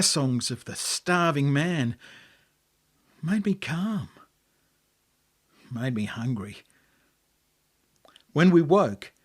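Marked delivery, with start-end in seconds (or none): none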